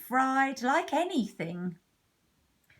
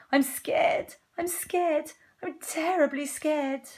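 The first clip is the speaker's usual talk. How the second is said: high frightened refrain; hammed-up scared cook; acted fear; 7 semitones higher, slower than usual. Cook declares his fear.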